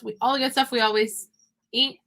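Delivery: ordinary talk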